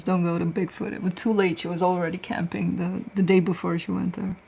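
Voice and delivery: ordinary talk, nothing marked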